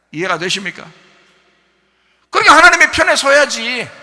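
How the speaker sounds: rising, loud sermon delivery; fervent preaching. Acted. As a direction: drive the point home to the congregation. Preaching at full force. (열정적인 설교)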